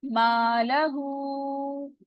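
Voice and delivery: मालहू very slowly with a long vowel; stretched madd. Shown as a demonstration. Teacher stretching मालहू for the madd. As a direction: stretch मालहू for four counts. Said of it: "four count stretch करना है".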